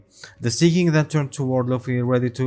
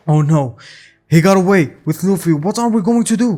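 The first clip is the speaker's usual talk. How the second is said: raised, hurried alarm; frantic yell; 6 semitones higher, 6 dB louder, faster than usual. A narrator's reading. Shanks frets that Higuma got away with Luffy, frantic yell. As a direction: climb higher in a flustered rush. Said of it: "Shanks yelled with, like, running around".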